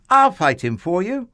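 high, loud, eager offer; young boy hero. A narrator's reading. David volunteers to fight the giant, high bold boy's voice. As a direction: pipe up boldly with a boy's voice.

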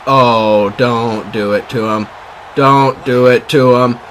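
loud, relishing warning; excited taunt. Real, not acted. Streamer breaks away on a return, loud excited taunting. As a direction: call it out loud, drawing out the words. Return run about to score.

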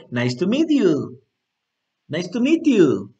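"nice to meet you" linked without gaps; words joined. Shown as a demonstration. Teacher modelling the joined phrase, "meet you" linked. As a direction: run "meet you" together as one word. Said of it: "lo puedes como juntar".

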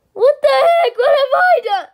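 high, loud wailing protest; upset cry. Acted. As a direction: cry out hurt. Hurt, crying out.

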